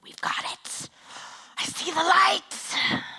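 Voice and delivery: high, loud, slow hallelujah cry; hammed-up breakthrough shout; proud parent celebrating a potty-training win; mock triumph. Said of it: "you're like".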